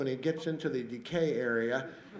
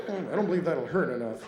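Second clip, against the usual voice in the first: usual speaking voice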